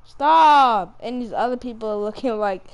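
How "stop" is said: sharp loud shout of stop; one-word burst, loud; sudden yell. Real, not acted. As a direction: yell it out at a teammate. Gamer yelling during play.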